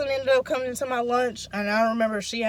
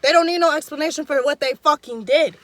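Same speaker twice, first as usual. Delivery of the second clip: raised, heated comeback, a bit louder; angry retort; 4 semitones higher, 4 dB louder than usual. Retold or imitated. Telling off Daisy in the cafeteria, angry and raised. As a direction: snap at the friend explaining everything. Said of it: "So I get mad again".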